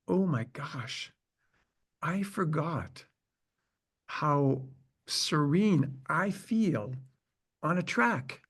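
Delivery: slow, soft and serene, lingering on each word; hushed wonder. Retold or imitated. Speaker marvels to himself on the track, slow and hushed. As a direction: let it drift out in quiet awe.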